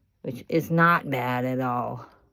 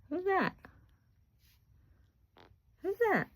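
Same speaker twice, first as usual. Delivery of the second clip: coaxing pet talk. Real, not acted. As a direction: coax a bird to answer, squeaky.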